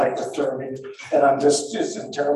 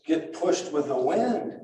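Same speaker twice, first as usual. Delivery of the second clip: ordinary talk, nothing marked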